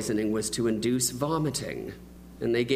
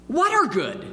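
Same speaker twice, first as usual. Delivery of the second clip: put-on much higher, curious kid voice; child friend's voice; 13 semitones higher, 5 dB louder than usual. Friend questioning the boy, high childlike curious voice. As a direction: play the puzzled little boy.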